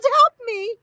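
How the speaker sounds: high, loud panicked wail. A narrator's reading. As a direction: cry out in fright. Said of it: "she wailed".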